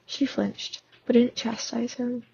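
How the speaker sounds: plain reading voice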